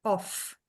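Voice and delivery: "off" ending in a clear F; teacher models the strong word; f sound. Shown as a demonstration. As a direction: end "off" with a crisp voiceless F. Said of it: "a gente vai pronunciar".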